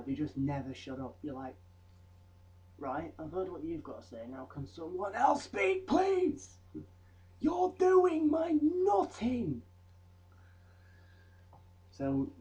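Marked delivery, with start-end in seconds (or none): 2.8-9.6 rising into a high, loud, drawn-out plea; mock frustrated squeal; exasperated outburst. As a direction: beg them to stop talking, voice cracking high. Exasperated at people who never shut up.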